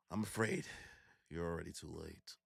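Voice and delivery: low, very soft menace; ominous Zoro. Retold or imitated. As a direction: murmur it like bad news. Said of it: "And Zoro's like".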